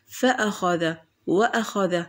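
فأخذ، وأخذ with a glottal stop after fa and wa; hamza pronounced. Shown as a demonstration. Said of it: "أيضًا نطقناها".

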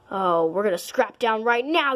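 normal talking voice

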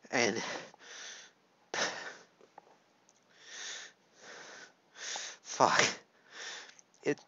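a quiet, low, broken curse; words give out as the tears come; breaking down. Real, not acted. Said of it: "getting this emotional on camera".

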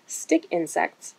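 usual speaking voice

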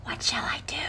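soft breathy whisper. A narrator's reading. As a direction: whisper it, barely voiced. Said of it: "he whispered to the squirrel".

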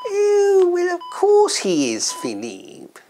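high, drawn-out royal voice; a hint of a shocked old queen, slowly; mock Queen, startled, high. Queen answering dying husband.

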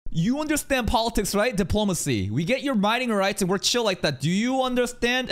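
patronizing. Retold or imitated. Reactor parodies the envoy explaining politics to a girl, patronizing. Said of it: "Felt a little patronizing".

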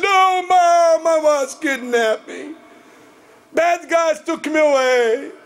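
high, slow, hammed-up wail; mock pleading. Sister telling mom bad guys took her, exaggerated pleading. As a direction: drag out the victim's excuse dramatically.

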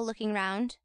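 ordinary delivery, nothing marked

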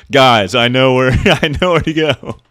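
excited laughing. Real, not acted. Excited discovery in game.

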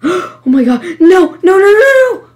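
panicked high-pitched yelling; panicking. Real, not acted. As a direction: gasp into high, loud panicked shouting. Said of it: "I'm panicking".

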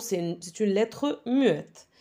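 usual speaking voice

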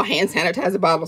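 put-on "hand sanitizer bottle" in a child's muddled wording; quick, garbled "hand sanitizer bottle"; Kyla's pronunciation. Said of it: "that's what Kyla used to call".

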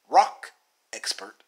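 ordinary delivery

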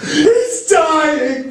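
high, wailing cry of alarm; high-pitched distraught shout. A narrator's reading. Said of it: "she shouted".

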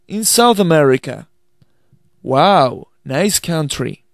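slow, careful English for learners to repeat; slow dialogue demo. Shown as a demonstration. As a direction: read each line clearly, slowly, as a model to repeat. Final read of dialogue for repetition.

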